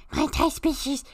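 ordinary talk

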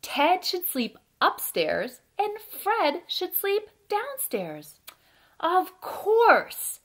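loud, deliberate picture-book narration with pauses; reading to children. A narrator's reading. Storyteller reads a bedtime tale about Fred and Ted, lively read-aloud. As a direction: read it out with big, bouncy emphasis.

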